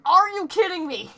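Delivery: disbelief. Retold or imitated. Retelling shock at a donation.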